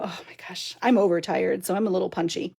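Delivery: ordinary talk, nothing marked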